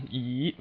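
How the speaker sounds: E dipping slightly, then rising; third tone. Shown as a demonstration. Teacher shows learners the third tone, dip-then-rise E. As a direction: let E sag in the middle and climb. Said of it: "in the middle there's a slight dip".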